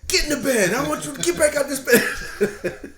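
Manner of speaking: high, loud mock-stern command; angry dad order. Father ordering his daughter to bed, loud and mock-stern. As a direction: ham up the scolding father. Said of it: "father scolding daughter".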